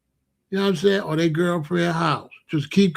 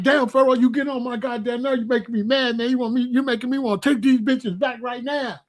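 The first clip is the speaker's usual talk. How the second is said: went crazy; 5 semitones higher than usual. Retold or imitated. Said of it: "Boy, he went crazy".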